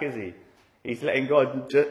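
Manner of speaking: ordinary talk, nothing marked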